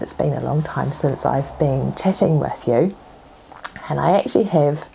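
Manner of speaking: ordinary talk, nothing marked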